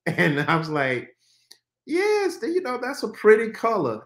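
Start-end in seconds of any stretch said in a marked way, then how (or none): 1.9-4.0 high-pitched, unconvincing compliment to a wife; unexcited praise. Retold or imitated. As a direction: try to sound approving without real excitement. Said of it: "maybe it's the lack of excitement in my voice".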